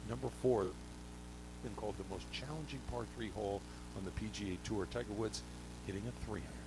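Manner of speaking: hushed golf announcer voice; hushed, reverent TV golf call. Pretend. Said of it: "Let me hear your golf announcer voice".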